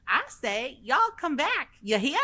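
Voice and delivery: high squeaky raccoon drawl. Retold or imitated. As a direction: chirp it up high like a tiny cowboy raccoon. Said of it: "He says".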